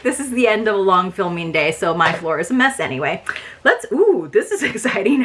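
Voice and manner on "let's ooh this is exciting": a sudden squeal of real delight, pitch jumping higher, laughing; excited. Real, not acted. Unboxing a surprise for viewers, real excited squeal. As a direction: gasp with delight at the next item. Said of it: "I just got so excited".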